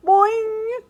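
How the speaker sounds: loud, very high springy boing; frog's boing. A narrator's reading. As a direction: twang it out like a frog. Frog answers the hamster.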